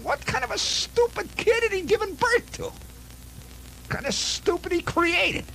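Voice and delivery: high-pitched mock rant of an outraged dad; furious father. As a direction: play the old man fuming, voice pushed way up. Father ranting about stupid kid.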